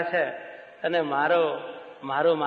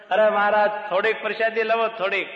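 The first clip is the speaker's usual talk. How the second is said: higher, louder begging; pleading swami; 5 semitones higher, 3 dB louder than usual. Acted. Brahmanand Swami begs Maharaj for some prasad, high pleading. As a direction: coax Maharaj for just a little.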